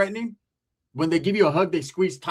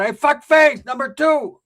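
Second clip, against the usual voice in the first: loud, higher bossy aunt; yelling tia; 7 semitones higher, 7 dB louder, slower than usual. Retold or imitated. Tia voiced on phone.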